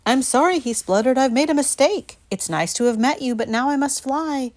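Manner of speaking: high, loud panicky spluttering; frightened dragon. A narrator's reading. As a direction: splutter out an apology in fear. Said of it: "he started to shake".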